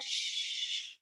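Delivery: soft shushing breath; hushing. Retold or imitated. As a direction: hush an unruly part of yourself. Hushing her own ego.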